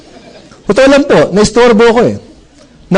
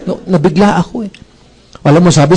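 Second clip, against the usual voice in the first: ordinary talk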